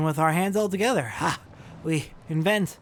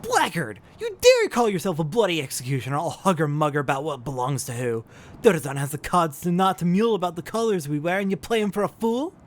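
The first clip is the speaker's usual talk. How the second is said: angry; 3 semitones higher than usual. Acted. Captain scolds crewman.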